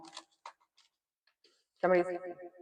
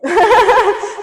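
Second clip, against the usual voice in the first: loud laugh; 14 dB louder than usual. Real, not acted. Laughs at her own joke.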